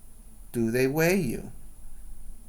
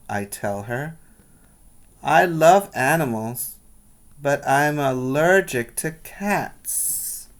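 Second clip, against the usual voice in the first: put-on lively read-aloud for children; storybook reading voice; story narration; 6 dB louder than usual. A narrator's reading. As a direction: tell the story brightly to the class. Teacher reads picture book aloud.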